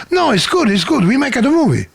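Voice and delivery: put-on jokey Italian accent brushing off the question; comedy Italian accent. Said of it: "do him in a comedy Italian accent".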